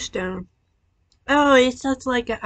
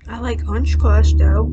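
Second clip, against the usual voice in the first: suddenly louder, bright exclaim; 10 dB louder than usual. Real, not acted. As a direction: blurt it out loudly. Sudden loud remark while tasting.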